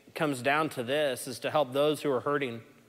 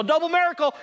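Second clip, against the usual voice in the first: high, loud, brisk declaration; cheery nurse calling a double miracle; excited announcement; 12 semitones higher, 5 dB louder, faster than usual. Retold or imitated. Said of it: "And she's all".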